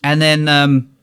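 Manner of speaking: usual speaking voice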